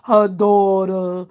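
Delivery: slow, drawn-out fawning mimicry; mocking fawning voice. Mocking him sucking up to Fi Baby, slow and fawning. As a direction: ham up his sucking-up, slowly. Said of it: "when he over there sucking Fi Baby ass".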